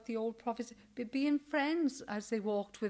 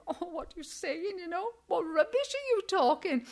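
exasperated; 6 semitones higher than usual. Retold or imitated. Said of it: "and I think".

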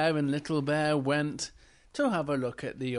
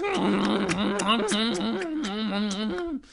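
rapid, noisy mouth chomping; apple-chomping noise; 6 semitones higher, 4 dB louder than usual. Acted. Little Bear chomping lots of apples, noisy munching. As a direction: imitate greedy munching, higher than speech.

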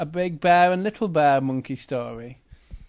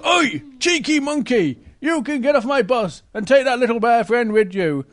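loud, raised-pitch telling-off; cross bus driver; 5 semitones higher, 7 dB louder than usual. Acted. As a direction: order them off like an angry driver. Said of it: "the bus driver wasn't very happy".

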